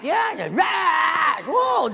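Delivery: slow, loud, hammed-up roar; husband taking anger home, mock roar. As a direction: roar like an angry beast. Yelling at wife illustration.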